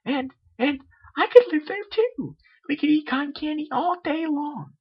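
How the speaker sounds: very high, bubbly cartoon pony; high and loud, childlike insistence; Pinkie Pie voice. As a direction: squeak it out like a hyper party pony.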